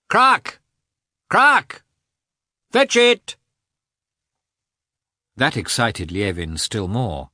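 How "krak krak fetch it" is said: high, loud calls to a hunting dog; cry to the dog. A narrator's reading. Hunter calling his dog across the marsh, loud high cry. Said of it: "followed almost every time by a cry to the dog".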